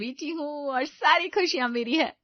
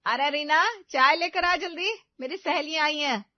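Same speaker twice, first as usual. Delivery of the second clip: loud, high call from across the house; mother-in-law yells at daughter-in-law for tea, loud and bossy; 4 semitones higher, 7 dB louder than usual. Acted. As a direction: shout the order like she owns her.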